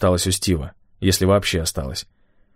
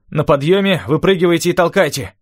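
raised, commanding voice; giving orders; 9 semitones higher, 3 dB louder than usual. A narrator's reading. Rafael instructing the others before the climb, commanding. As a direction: order the crew in a high, energetic voice. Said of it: "велел Рафаэль".

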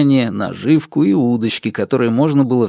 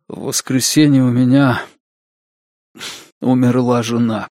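lifeless confession with a long halting pause; understated, hollow admission broken by a pause; lifeless, false-sounding. A narrator's reading. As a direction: say it emptily, as if the words ring false. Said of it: "они показались ему безжизненными и абсолютно фальшивыми".

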